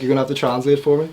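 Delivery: put-on Scouse accent. J-Dog at the airport asks his friend, in fast Scouse accent. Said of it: "He's like".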